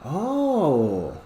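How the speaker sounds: high puzzled huh then realising; confused kids. Retold or imitated. Losing teams reacting, puzzled and high. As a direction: sound puzzled then get it. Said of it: "the other teams are like".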